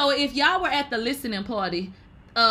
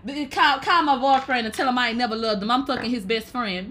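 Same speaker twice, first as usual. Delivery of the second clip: ordinary talk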